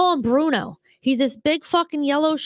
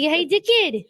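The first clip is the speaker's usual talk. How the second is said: Australian accent; 6 semitones higher, slower than usual. Shown as a demonstration. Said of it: "I wanna give him an Australian accent".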